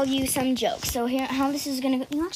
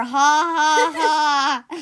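mock laugh; 3 semitones higher, 5 dB louder, much slower than usual. Fake laugh after joke.

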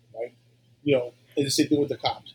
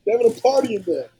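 mock alarm; 10 semitones higher, 5 dB louder than usual. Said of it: "and you're like".

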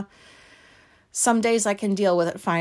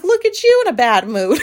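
teasing through laughter, a bit louder; laughing teasing; 4 semitones higher, 7 dB louder than usual. Retold or imitated. Teasing her wife about the bad mood, laughing. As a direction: poke fun at her mood while laughing. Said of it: "I couldn't stop laughing".

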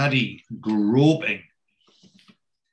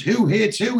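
shocked question; 10 semitones higher, 5 dB louder than usual. Retold or imitated. Boss shocked by the feud.

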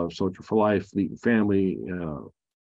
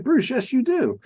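much higher mock wife insisting; 14 semitones higher than usual. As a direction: squeak out the wife's insistence. Wife contradicts him, voiced high.

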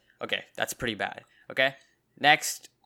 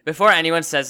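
loud emphatic; 5 semitones higher, 10 dB louder than usual. Real, not acted. Loud lead-in before a defense.

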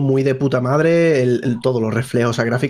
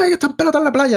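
shocked shriek; 14 semitones higher, faster than usual. Retold or imitated. Voicing startled beachgoers in trailer.

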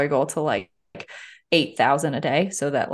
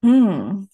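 thinking hmm; 2 semitones higher, 6 dB louder than usual. Real, not acted. Asked a question, ponders.

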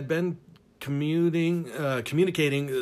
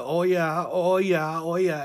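higher-pitched Northeastern surfer impression; mock Maine-surfer accent; 4 semitones higher, 3 dB louder than usual. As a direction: mimic her repeated Maine-accented moans. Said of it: "she starts in with the".

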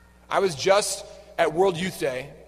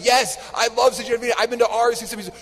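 loud, raised, gushing answer; retold excited reply; 5 semitones higher, 6 dB louder than usual. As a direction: act out matching someone's thrilled enthusiasm. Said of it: "And I'm like".

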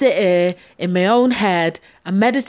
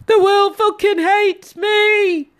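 very high, slow, hammed-up self-pity; mock melodramatic wail; 13 semitones higher, 4 dB louder, slower than usual. Mocking her period moods in a high wail. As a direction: whine it like a teen in despair. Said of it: "I'm like".

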